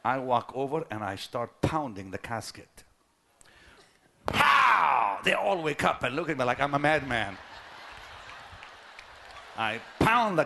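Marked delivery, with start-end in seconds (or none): 4.2-5.2 loud, high shouted "Pow!" for the blow; sharp shouted impact sound. Retold or imitated. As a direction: bang it out like a fist on wood. Pounding the casket.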